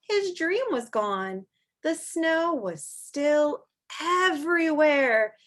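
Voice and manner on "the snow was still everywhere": louder, higher, slow wonder; joyful reveal. A narrator's reading. Reading to children, slow delighted wonder. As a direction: marvel at the new snow. Happy snowy morning.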